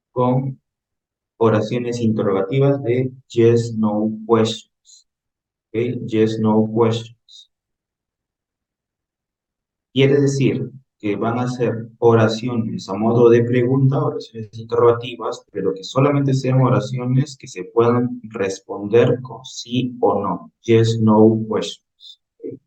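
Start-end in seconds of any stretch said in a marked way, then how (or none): 3.3-5.1 yes, no questions dicho despacio, cada palabra marcada; slow teaching model. Shown as a demonstration. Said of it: "teacher slowly models English term". 6.1-7.5 yes, no questions dicho despacio, cada palabra marcada; slow teaching model. Shown as a demonstration. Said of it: "teacher repeats term slowly". 20.6-22.2 yes, no questions dicho despacio, cada palabra marcada; slow teaching model. Shown as a demonstration. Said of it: "teacher repeats term slowly".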